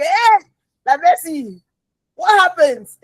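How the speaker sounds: high, loud outburst; startled exclamation. Acted. Preacher reacting to someone's changed behaviour, startled. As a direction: cry out in mock alarm.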